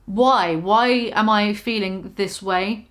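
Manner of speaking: slow, searching inner question; slow reflective self-questioning. Retold or imitated. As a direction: ask yourself slowly, searching.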